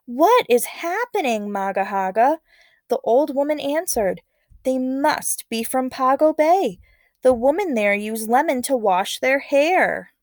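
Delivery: lively read-aloud narration voice; story narration. A narrator's reading. Teacher reading a legend to students, expressive narration.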